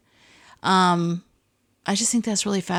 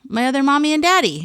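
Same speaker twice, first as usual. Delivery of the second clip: put-on higher, faster, like a puzzled toddler insisting; a small child who thinks everyone should know; insistent child; 8 semitones higher, 4 dB louder, faster than usual. Grandmother voicing the grandson's insistent claim, high and quick. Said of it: "as if she had to know who they were".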